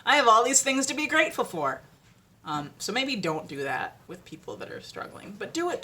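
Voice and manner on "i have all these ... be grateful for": loud, high, gushing gratitude; gushing cheer. Retold or imitated. Speaker mocks someone gushing at a struggler, loud and bright. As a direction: beam it out, over the top. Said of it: "And you're like".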